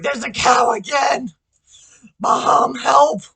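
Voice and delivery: loud, high mock wail for help; hammed-up cry for rescue; mock panic. Texting mom about the cow, loud mock wail.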